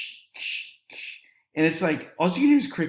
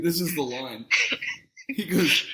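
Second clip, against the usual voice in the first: usual speaking voice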